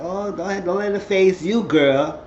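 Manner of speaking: retold cheer to a girlfriend; egging her on. Cheering a friend on.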